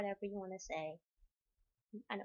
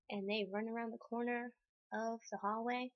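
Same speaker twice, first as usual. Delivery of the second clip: normal talking voice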